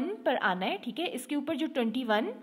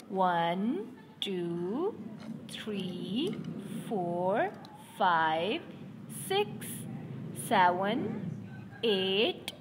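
counting one number at a time with pauses; slow counting aloud; 3 semitones lower, slower than usual. Shown as a demonstration. Teacher counting stethoscopes aloud with her students. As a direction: count slowly so children can follow along.

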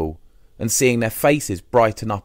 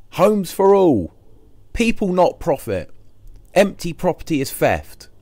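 high, emphatic banner slogans; proclaimed slogans; 9 semitones higher, 4 dB louder than usual. Acted. Squatter reads out the unfurled banners, proclaimed slogans. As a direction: proclaim each slogan like a rallying call.

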